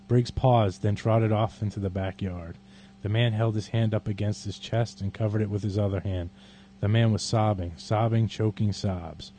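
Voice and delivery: measured dramatic poem reading; poetry reading. Acted. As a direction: read the poem deliberately and darkly. Reads Bukowski poem aloud.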